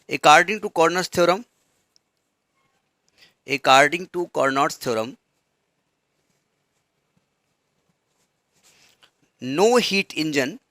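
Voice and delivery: slow, word-by-word dictation pace. Shown as a demonstration. Teacher dictating a definition to students, very slow. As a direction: spell it out slowly so they can write. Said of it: "तो लिखो जरा".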